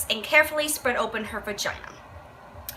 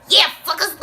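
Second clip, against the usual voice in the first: mock baby; 6 semitones higher, 4 dB louder than usual.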